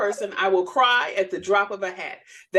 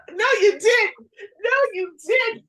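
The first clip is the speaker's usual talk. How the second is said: much higher, louder disbelief; mock shock; 12 semitones higher, 6 dB louder than usual. Real, not acted. Host reacting to friend's shameless book plug, high scandalized disbelief. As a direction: gasp it out, scandalized.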